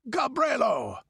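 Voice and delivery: high, furious shout of a name; enraged, commanding yell; roaring summons. A narrator's reading. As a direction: bellow for your servant. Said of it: "roared Valenzo".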